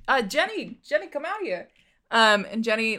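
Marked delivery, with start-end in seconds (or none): 0.1-1.7 raised pitch, acted call to another room; calling someone out. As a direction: call out in a high character voice. GM as Liam calls Jenny out.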